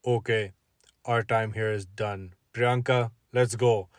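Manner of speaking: a hint of a low, curt father; lightly voiced dad ending the meeting; stern dad. Father ending the date, low and curt. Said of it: "So then he said".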